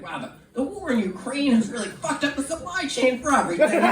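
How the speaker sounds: high-pitched mock exasperated father; lightly acted fatherly dismay; upset dad. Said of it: "he was also really upset".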